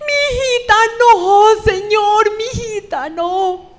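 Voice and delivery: high, slow, loud anguished wail; a father grieving over his daughter; weeping cry. Retold or imitated. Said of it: "Y empieza a llorar y grita y dice". (lamento de padre, agudo, lento y lloroso)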